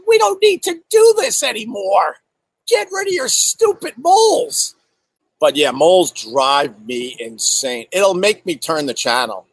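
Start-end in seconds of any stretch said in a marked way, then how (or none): 0.1-4.7 high, exasperated plea; mock pleading. To people with hairy moles, high and exasperated. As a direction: beg them high and fed up. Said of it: "I'm like".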